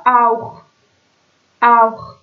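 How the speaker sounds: آوخ with a throaty German kh at the end; German ch sound. Shown as a demonstration. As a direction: scrape the خ in آوخ at the back of the throat.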